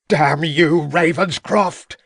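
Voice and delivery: the cornered villain screaming at the inspector; furious yell. A narrator's reading. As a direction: hurl the curse as he charges. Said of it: "yelled Mountcourt".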